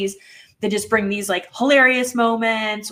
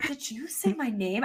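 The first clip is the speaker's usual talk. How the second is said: imitating Abby; 3 semitones higher, 7 dB quieter than usual. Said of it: "I don't wanna do her accent".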